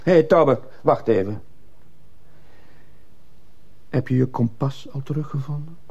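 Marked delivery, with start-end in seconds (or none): 0.0-1.4 loud, high call after someone. Acted. Teacher calls after departing pupil, calling out. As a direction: call him back sharply.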